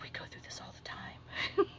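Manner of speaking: breathy whispered aside to the listener. Retold or imitated. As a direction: lean in and whisper it like a shared secret.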